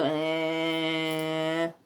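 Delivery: "eh" held long, low and soft, front vowel; disbelieving choni drone. Shown as a demonstration. Teacher acting someone who doesn't believe it, long low "eh". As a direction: draw out a doubtful "eh". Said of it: "Te cuentas algo que no te crees y tú".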